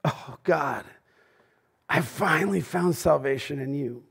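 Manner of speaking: soft, breathless praise to God; hushed and awed; new believer rapture. Pretend. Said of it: "day of your salvation feels the same".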